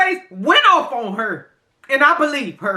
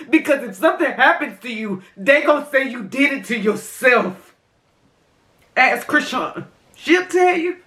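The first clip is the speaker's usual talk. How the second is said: ordinary delivery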